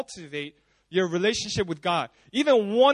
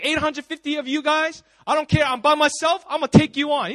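louder, higher gangster swagger; acted tough guy taking on all comers; fearless bravado; 5 semitones higher, 5 dB louder than usual. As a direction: brag it out, voice raised. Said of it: "he's not afraid".

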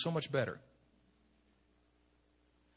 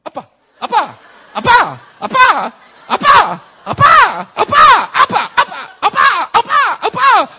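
put-on baby calling appa; 20 dB louder than usual. Imitating baby calling daddy.

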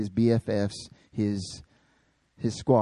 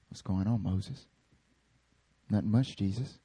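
hushed casual chat; 8 semitones lower, 9 dB quieter than usual. Retold or imitated. Casual chat of Jesus and prophets.

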